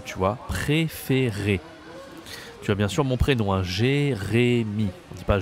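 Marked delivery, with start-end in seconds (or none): 0.5-1.6 slow "Préféré" with closed é sounds; careful teaching voice; slow model word. Shown as a demonstration. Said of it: "La voix est un peu aiguë". 3.6-4.9 "Jérémy" drawn out, clean é sounds; careful teaching pronunciation; slow model name. Shown as a demonstration. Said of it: "Non, Jérémy".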